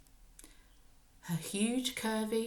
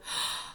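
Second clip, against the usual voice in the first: gasp; 4 dB quieter than usual. Acted. Swallowing burning potion.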